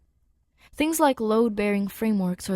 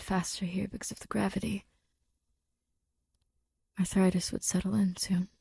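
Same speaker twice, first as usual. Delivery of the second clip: whispered story reading; whispered narration. A narrator's reading.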